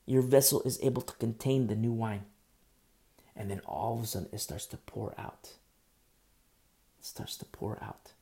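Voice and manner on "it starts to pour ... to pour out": whispered and slow. Real, not acted. Preacher whispering the outpouring to his listeners. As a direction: breathe it out in a whisper. Preacher repeats line in hush.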